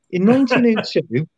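high, mock-portentous quiz question; S1 mocking the quizmaster's tricky question. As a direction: ham up a quizmaster reading out a question. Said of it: "dreading the questions where he goes".

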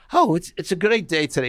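a hint of an Irish brogue greeting; mock brogue. [name] greets the studio guy in a light mock brogue. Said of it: "He was like in a brogue or something".

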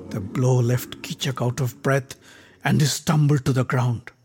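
ordinary delivery, nothing marked

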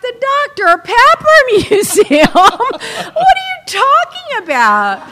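high, loud, slowed laughing disbelief; scoffing at mom's museum suggestion; incredulous laughing. Retold or imitated. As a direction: laugh off the silly idea. Mocking mother's suggestion.

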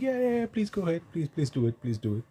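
high, soft, quick pleading that slides back down; mock eager urging. Batsman goading his partner to answer the sledger, mock eager urging. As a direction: egg them on in a small high put-on voice.

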